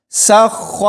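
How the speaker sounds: سخ with a hard, pressed doubled kh; firm shadda. Shown as a demonstration. Teacher showing letters with shadd, joined hard. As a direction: lean firmly on the kh of سخ. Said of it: "سختی سے ملانا ہوتا ہے".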